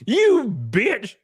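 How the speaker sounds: laughing, high shocked oh; mock outrage. Streamer pretending outrage at a chatter through laughs. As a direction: fake offense through laughter.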